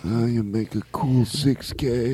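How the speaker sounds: Godfather voice. Acted. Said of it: "Godfather impersonations".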